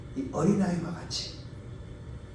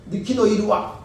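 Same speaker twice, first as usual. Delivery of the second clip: angry call; 5 semitones higher, 8 dB louder than usual. Retold or imitated. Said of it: "화를 냈죠".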